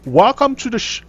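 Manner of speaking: high, faltering attempt at British; British accent. Shown as a demonstration. Streamer trying to speak like British, faltering mock accent. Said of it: "let's try and speak like British".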